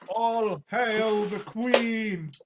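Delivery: slow, high, loud royal proclamation; proclaiming. Acted. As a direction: herald announcing the queen. Hailing the queen's arrival.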